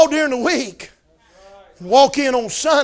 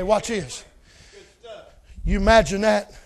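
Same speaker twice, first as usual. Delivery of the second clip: ordinary talk, nothing marked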